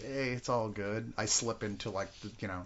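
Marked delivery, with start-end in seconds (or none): 0.0-1.1 soft, slow, laid-back Southern drawl; Sam Elliott. Retold or imitated. Telling cohosts how whiskey makes him sound, soft slow Sam Elliott drawl. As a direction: drawl it easy, like a drunk cowboy narrator. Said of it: "sounding like Sam Elliott".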